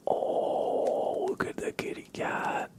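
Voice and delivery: ordinary talk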